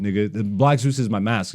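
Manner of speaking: ordinary talk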